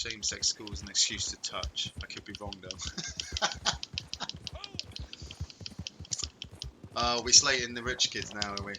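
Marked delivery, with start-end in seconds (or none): 2.8-6.9 long, high burst of laughing; real laughter. Real, not acted. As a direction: crack up at your own joke. Laughs at own rugby joke.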